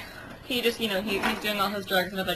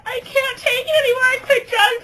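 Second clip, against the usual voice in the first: much higher, tearful acted wail; acted crying; 14 semitones higher, 6 dB louder than usual. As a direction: wail it like a heartbroken duck. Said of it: "crying and crying and crying".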